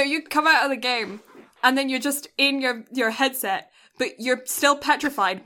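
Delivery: ordinary talk, nothing marked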